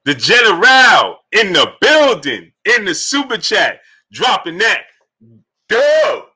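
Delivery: loud, high, drawn-out shout-out; hype announcement. Real, not acted. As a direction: call out the donor like an arena announcer. Greeting a donor on stream.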